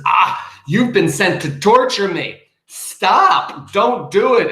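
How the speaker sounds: high, loud cry to the bird; pleading outburst. Retold or imitated. Speaker pleads with the raven, high and loud. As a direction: beg the bird to stop tormenting him. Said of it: "he looks at the bird and he says".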